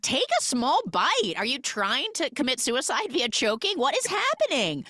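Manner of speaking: high, escalating exasperation; exasperated disbelief. Real, not acted. Viewer scolding Britt over giant cookie, exasperated. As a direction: pitch climbing with each baffled question.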